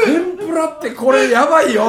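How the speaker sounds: loud, high mock alarm; retold alarm. Storyteller recalls seeing tempura served, comically alarmed.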